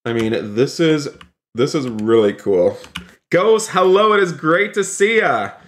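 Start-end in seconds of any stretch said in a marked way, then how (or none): 3.3-5.5 high-pitched, eager hello; excited greeting. Real, not acted. Streamer welcoming Ghost to the store, excited greeting. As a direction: greet them brightly, pitched well up. Greets a player who appears.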